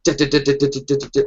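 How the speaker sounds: quick, even staccato syllables; rapid fire. Shown as a demonstration. Showing Spanish or Italian cadence, rapid-fire patter. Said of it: "They're super rapid fire when they talk".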